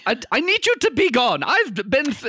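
high, anxious pleading; nervous shopkeeper. Acted. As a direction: beg them off in a high voice. Shopkeeper begs them to leave.

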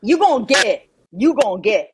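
"get" stretched in imitated drawl; Southern drawl. Shown as a demonstration. Nailing it for co-host, "get" in Southern drawl. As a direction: copy Southern "get" with care. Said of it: "There you go".

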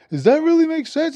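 high-pitched mock doubt; sceptical question. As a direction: imitate someone querying your logic, pitch up. His retold sceptical question.

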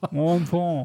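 mock French Montreal, muffled; French accent. Shown as a demonstration. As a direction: say "Montreal" like a Québécois, mouth full. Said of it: "put a dick in your mouth".

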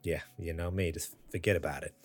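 quiet mock New York accent; a soft New York "forget about it". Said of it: "heavy New York accent".

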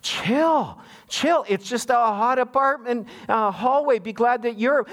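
high-pitched, pleading mock exasperation; hammed-up "calm down already"; exasperated calming. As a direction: talk down an overwrought relative. Retold reply to ranting aunt.

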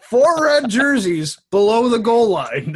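loud, high commentator impression; Mickey Redmond. Host mimicking a TV commentator reading off a teleprompter, loud impression. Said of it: "it would just be Mickey Redmond".

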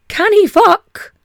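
ordinary talk, nothing marked

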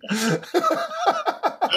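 high, slow words dissolving into laughter; laughing while talking. Real, not acted. Guest telling host about a diet prank, laughing. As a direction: crack up mid-sentence.

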